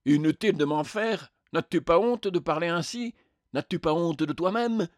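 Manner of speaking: outraged spectre. Acted. Spectre scolds the unrepentant killer, outraged. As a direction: shame the other with indignant questions.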